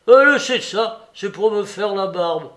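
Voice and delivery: loud, high, flustered outcry; flustered protest. A narrator's reading. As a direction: protest in alarm at the meddling. Old man protests at meddling.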